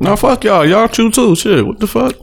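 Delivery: high, sharp hungry irritation; irritated snapping. Real, not acted. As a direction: snap back like you're starving. Hungry friend snaps back.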